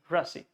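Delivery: rasi as "ruh-see", short first vowel; schwa sound. Shown as a demonstration. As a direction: say rasi with A reduced to UH. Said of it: "I'm saying rasi".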